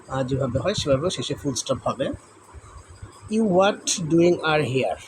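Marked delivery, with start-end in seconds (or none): 3.3-5.0 slow scrambled read. Shown as a demonstration. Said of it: "উলটপালট করে দেওয়া রয়েছে".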